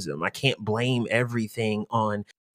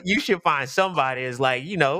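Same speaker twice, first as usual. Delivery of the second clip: ordinary talk, nothing marked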